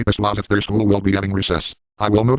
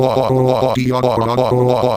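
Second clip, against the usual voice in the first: exaggerated louder mock moans; mock moaning; 3 semitones higher, 3 dB louder, slower than usual. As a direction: moan it out loud as a joke. Moaning while using tank guns.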